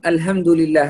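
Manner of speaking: الحمد لله with plain h in place of ح; ha mispronounced. Shown as a demonstration. Teacher warns against a wrong letter, plain h in الحمد. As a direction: say الحمد with هاء, not the throat ح. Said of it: "الهاء".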